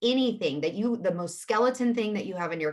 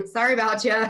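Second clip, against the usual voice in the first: loud, higher-pitched joking sorry; laughing mock apology; 5 semitones higher, 9 dB louder than usual. Real, not acted. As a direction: toss off a jokey apology through laughter.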